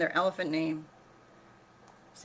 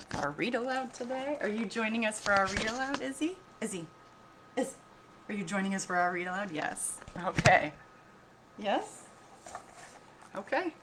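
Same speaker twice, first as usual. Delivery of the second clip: playful pet-talk voice; talking to the dog; 3 semitones higher than usual. Real, not acted. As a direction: coax the dog sweetly.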